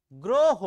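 গ্রহ called out loud and firm; demonstrated pronunciation. Shown as a demonstration. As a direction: say গ্রহ loudly with an o in the first syllable. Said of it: "শব্দ এসেছে গয় রফলা হ".

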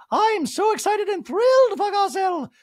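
very high, loud gushing voice; Dorit impression. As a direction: gush over her friend in a squeaky put-on voice. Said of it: "Dorit in a talking head goes".

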